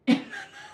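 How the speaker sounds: high-pitched giggle burst; shrill laugh burst; high squealing laugh. Real, not acted. Laughs at water on cotton.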